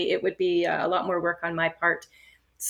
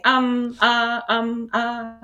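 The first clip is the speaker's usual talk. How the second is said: mock a speaker stumbling over um and uh; halting public speaker; 3 semitones higher, much slower than usual. Teacher mimics a live public speech full of fillers, halting and hesitant. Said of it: "somebody keeps saying".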